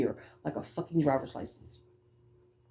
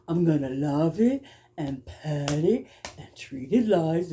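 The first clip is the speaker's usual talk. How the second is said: cartoon character; slower than usual. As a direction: play the cartoon animal cooing over the mouse. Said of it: "it reminded me of that cartoon".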